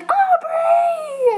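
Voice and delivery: very high, loud drawn-out scream of her name; ecstatic shout. Retold or imitated. Fan waving frantically at Aubrey, a high thrilled scream.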